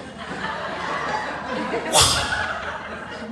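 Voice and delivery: wheezy, high laughter; real laughing fit. Real, not acted. Speaker cracks up telling the story, wheezing laugh. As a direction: break down laughing, hardly any voice.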